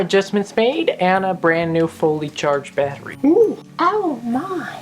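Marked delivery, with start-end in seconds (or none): 3.2-4.7 very high, slow, growing oh my; mock excited high voice. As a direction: gasp it out in a prissy high voice.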